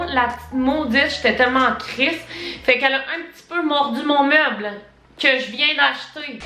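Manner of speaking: ordinary talk, nothing marked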